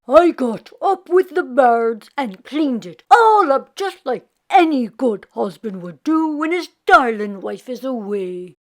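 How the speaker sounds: a jolly Irish fellow boasting to his lads; Jamie's voice. A narrator's reading. Jamie's voice in read story.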